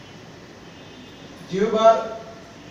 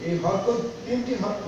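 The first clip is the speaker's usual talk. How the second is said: ordinary delivery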